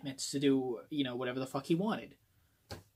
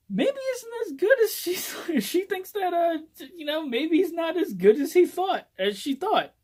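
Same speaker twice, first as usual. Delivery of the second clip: much higher, laughing through the words; giggly high laughter; 14 semitones higher, 6 dB louder than usual. Real, not acted. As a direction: giggle it out, amused at the twist. Giggling over the plot twist.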